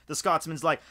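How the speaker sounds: ordinary talk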